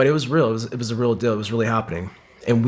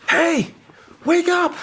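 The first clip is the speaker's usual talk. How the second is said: much higher, urgent call; calling him awake; 3 dB louder, slower than usual. Retold or imitated. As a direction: shout "hey" up high to wake a downed buddy. Friend kicking him awake.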